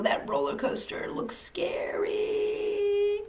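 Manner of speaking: high, slow, whispered awe; scared whisper. Acted. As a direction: hush it like it's frightening. Reader whispering about scary ride.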